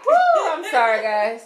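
high, loud whooping laugh; laughing it off, overtired; giddy laughing. Real, not acted. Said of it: "I'm a little sleep deprived".

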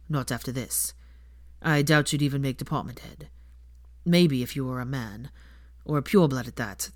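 a minister's heavy regret; sombre Kingsley. Acted. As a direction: break the bad news gently. Kingsley explains gravely.

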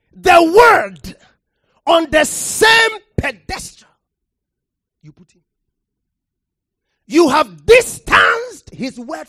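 loud, rising pulpit delivery, hammering the word; preacher thundering from the pulpit; full-force preaching. Acted. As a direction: preach it hard to the congregation.

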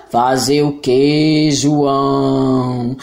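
voz grave e muito lenta de monstro; friendly monster voice. Acted. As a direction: monstro calmo, arrastado. (voz de monstro)

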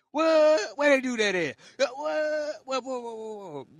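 high, loud shocked what. Real, not acted. Speaker reacting to two-faced friends, shocked disbelief. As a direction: yelp it in disbelief.

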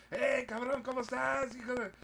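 high, loud buddy greeting; changed street voice. Acted. Host greeting a buddy in Spanish, loud high street voice. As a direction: holler a rowdy high-pitched Spanish hello. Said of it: "change your voice".

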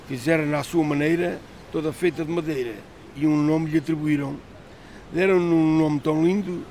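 rhythmic folk verse reading; poem recitation. Acted. Fisherman recites his poem.

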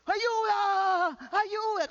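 high-pitched, drawn-out wail for help; little-voice cry for help. Acted. Man being robbed calls to the neighbours, little high-voiced cry. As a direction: cry out in a comically small, high voice. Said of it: "Con mi vocecita".

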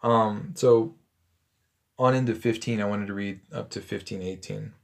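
ordinary delivery

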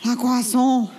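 hammy fake French accent; French chef. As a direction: play a cartoon French chef. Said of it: "this episode's accent".

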